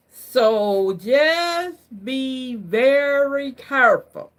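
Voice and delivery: slow and drawn out, every word weighed; slow it right down like a caution; slow warning. Shown as a demonstration. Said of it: "Can I say it like that?".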